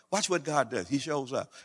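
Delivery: usual speaking voice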